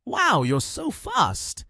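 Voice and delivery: high, drawn-out cheerful amazement. A narrator's reading. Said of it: "Kyun Nian cheerfully replied".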